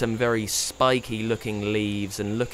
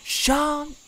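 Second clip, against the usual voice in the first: high squeaky refusal; squeaky spider sulk; muffled squeak. A narrator's reading. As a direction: refuse like a sulky child. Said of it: "came the muffled squeak".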